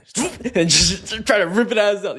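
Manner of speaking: high laughing rush as he yanks the toy; laughing burst. Real, not acted. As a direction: laugh while pulling the toy away. Sudden grab, laughing.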